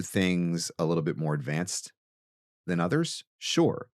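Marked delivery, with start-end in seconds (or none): none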